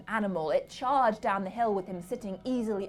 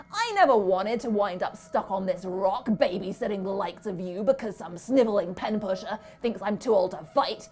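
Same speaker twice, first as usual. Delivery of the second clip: loud, higher ranting speech; just as loudly; 5 semitones higher, 17 dB louder than usual. A narrator's reading. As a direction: rant at the children from his steed. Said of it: "he went on just as loudly".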